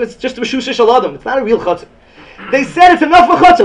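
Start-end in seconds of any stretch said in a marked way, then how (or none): 2.5-3.6 high, loud insistence; teacher insisting to the students, loud; emphatic. Real, not acted. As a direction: hammer the point home. Teacher stressing the point.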